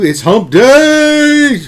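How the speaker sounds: long, high, loud drawn-out hump day cry; hump day shout. Acted. Host hypes the stream chat with a long loud hump day call. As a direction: stretch it out and belt it to the chat. Host's weekly catchphrase on stream.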